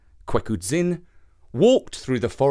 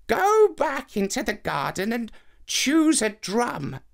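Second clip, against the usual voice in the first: put-on higher old woman giving instructions; old woman's voice; 8 semitones higher, slower than usual. Old woman instructs about drums.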